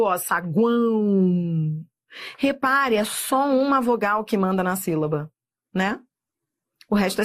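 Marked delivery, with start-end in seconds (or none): 0.2-1.8 saguão drawn out very slowly, the U glide held; slow demonstration. Shown as a demonstration. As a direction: stretch saguão to show the semivowel. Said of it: "ele tem som de U".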